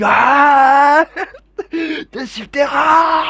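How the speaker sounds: cracking up mid-intro, loud high laugh; after laughing hard. Real, not acted. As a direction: burst out laughing at the title. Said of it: "je reprends du, depuis le début".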